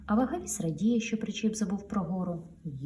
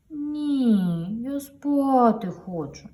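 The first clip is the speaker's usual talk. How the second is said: slow, higher drowsy refusal; sleepy trailer; 6 semitones higher, 5 dB louder, much slower than usual. Acted. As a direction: whine that you want to sleep. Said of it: "підказує причіп". (сонний причіп)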